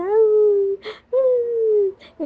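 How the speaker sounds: high whimpering mock sob "Mm, mm"; imitated crying. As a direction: whimper it like someone crying in her sleep. (gemido de llanto imitado, agudo)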